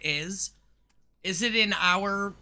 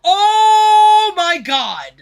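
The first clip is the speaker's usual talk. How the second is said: very high, loud, drawn-out slow cry; stretched-out shocked yell; outraged shout; 12 semitones higher, 13 dB louder, much slower than usual. Real, not acted. Outraged reaction to the video.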